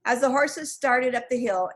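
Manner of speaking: ordinary delivery, nothing marked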